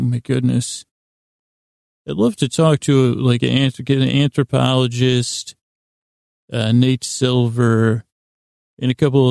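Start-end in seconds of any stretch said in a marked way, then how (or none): none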